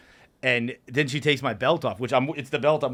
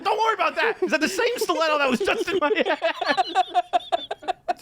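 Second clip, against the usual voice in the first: laughing panic. Retold or imitated. Comic demanding answers from Kevin through laughter. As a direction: drag it out while cracking up. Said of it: "I'm like".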